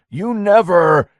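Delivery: Lenny shouting his denial at Mike; slow shout. A narrator's reading.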